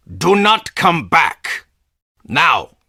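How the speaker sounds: loud, high, slow threat; furious warning. Acted. Boss orders the intruder never to return, loud and slow. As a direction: drive them out for good. Banishing the visitor.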